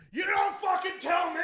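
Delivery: high-pitched furious yell, retold; retold screaming. Screaming at guitar store staff after falling off a ladder, outraged. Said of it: "And then I'm screaming at everybody".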